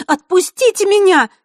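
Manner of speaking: высокий громкий крик вырывающейся девочки; кричи, вырываясь из рук; отчаянный крик. A narrator's reading. Девочку оттаскивают, она вырывается. (отчаянный высокий крик)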